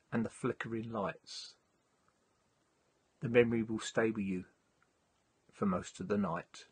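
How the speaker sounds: measured, gently paced poem recital; poem reading. Acted. Reads his poem.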